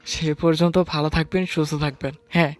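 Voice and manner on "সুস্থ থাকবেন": ordinary delivery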